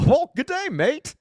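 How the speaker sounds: high-pitched, hammed-up Australian greeting; mock Australian accent. Host acting out the Dumb and Dumber line, high mock Australian accent. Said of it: "now that you acted it out".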